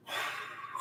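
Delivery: long voiceless exhale; weary sigh. Real, not acted. Man done venting about his prosecutor, weary sigh. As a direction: let out a soft sigh.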